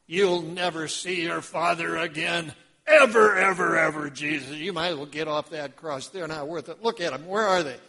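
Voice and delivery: loud jeering devil, shouted at the one on the cross; devil taunting. Acted. The devil taunts Jesus on the cross, loud jeering. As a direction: hammer him with it like the devil mocking.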